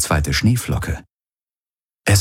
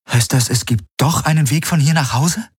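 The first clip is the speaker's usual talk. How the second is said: hopeful; 9 semitones higher than usual. A narrator's reading. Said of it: "Hoffnung leuchtete in Kirais ... Augen".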